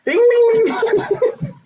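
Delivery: bell imitation. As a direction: chime it like a game show bell. Game show bell, laughing.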